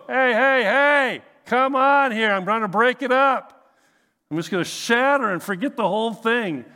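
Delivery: loud, high mock-panicked ship; panicking ship. Preacher imagines the ship in the storm, loud comic panic. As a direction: yell it like a ship about to crack apart. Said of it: "did the ship actually go".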